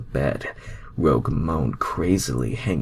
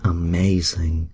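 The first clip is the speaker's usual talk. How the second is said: slow, satisfied sigh; lightly voiced contented sigh; sighing Rogue; 3 semitones higher, 3 dB louder, slower than usual. A narrator's reading. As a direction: drawl it out contented. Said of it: "Rogue gasped out, sighing in happiness".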